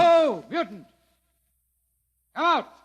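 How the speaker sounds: loud commanding call at the door. Acted. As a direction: bellow at the mutant to come out. Lieutenant calls mutant out.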